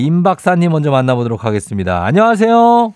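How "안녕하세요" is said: bright, high, loud hello; cheerful greeting. Real, not acted. Host greeting on air.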